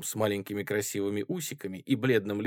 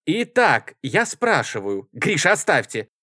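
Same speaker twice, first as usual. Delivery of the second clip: loud, raised, commanding call; shouting over everyone; 7 semitones higher, 8 dB louder than usual. A narrator's reading. Chairwoman silencing a comrade, shouting. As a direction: force the noisy room to listen. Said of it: "снова всех перекричала Варя".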